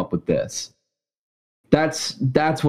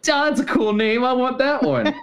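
much higher, goofy dimwit voice; dumb Dan voice; 13 semitones higher than usual. Retold or imitated. Teasing a co-host about his movie pick, in a high dopey mock voice. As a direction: play him as a simpleton grabbing a cool name. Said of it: "Dan's like".

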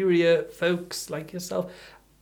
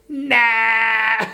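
dismissive laugh; 5 semitones higher, 5 dB louder than usual. Retold or imitated. Rejecting the child, driving off.

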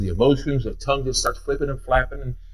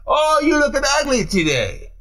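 mocking rude person; 10 semitones higher than usual. Mock rude person quoted.

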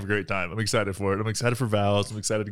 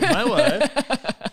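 laughing; 13 semitones higher than usual. Real, not acted. Repeating wife with a laugh.

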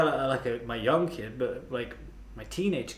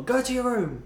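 high stern parent shout, a bit less loud; mock parent yell; 11 semitones higher, 4 dB louder than usual. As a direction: snap it like a cross parent.

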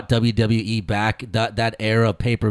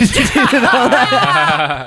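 laughing; 13 semitones higher, 4 dB louder, much slower than usual. Real, not acted. Laughing aside.

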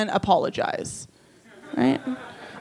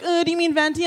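snooty barista; 8 semitones higher, faster than usual. Retold or imitated. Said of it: "they're like".

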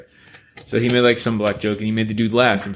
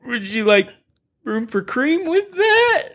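much higher, slow, sing-song mock barista. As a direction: stretch it out like an overly sweet cashier. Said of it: "And he was like".

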